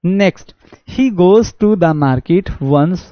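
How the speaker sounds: reading the sentence out slowly, word by word; drawn-out classroom reading; slow reading aloud. Shown as a demonstration. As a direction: read it like a dictation. Reads quiz sentence aloud.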